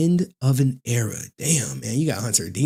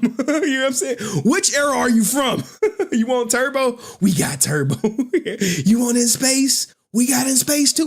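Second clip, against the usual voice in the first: laughing seller; 9 semitones higher than usual. Retold or imitated. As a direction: crack up while hawking. Laughing while voicing seller.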